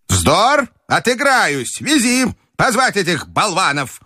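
put-on high-pitched, commanding old lady; imperious grandmother. A narrator's reading. Grandmother ordering to be wheeled to the tables, imperious. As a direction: order them about in a high granny voice.

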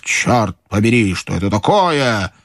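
ordinary delivery

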